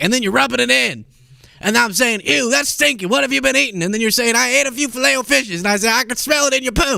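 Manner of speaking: mocking rant. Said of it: "And then he's like".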